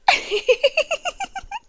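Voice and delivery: high, breathy little giggle; a delighted, creepy titter; amused villain giggle. Acted. Captor laughing at his victim's doubt, high giggle.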